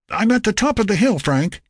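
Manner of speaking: high, projected call over a radio; announcing. A narrator's reading. Lynn reports to Frank over the comm set, announcing. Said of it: "Lynn announced over the comm set".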